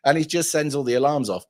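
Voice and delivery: ordinary talk, nothing marked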